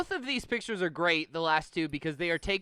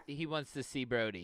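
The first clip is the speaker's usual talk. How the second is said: ordinary talk, nothing marked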